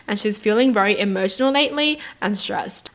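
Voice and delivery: ordinary talk, nothing marked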